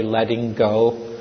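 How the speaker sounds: usual speaking voice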